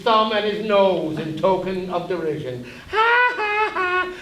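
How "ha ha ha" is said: high, taunting ha ha ha; mocking laugh. Dwarf conscience jeering at his master, high mocking laugh. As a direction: jeer up at him from the bookcase.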